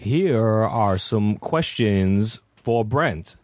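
extremely slow, drawn-out delivery; girlfriend impersonation. Acted. Guest showing how his girlfriend talks, very slow impersonation. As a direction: drag out every word deliberately. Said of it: "This is how she talks".

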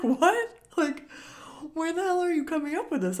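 high, loud mock disbelief; incredulous. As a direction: retell it as a high-pitched, baffled outburst. Acted disbelief at niece's claim.

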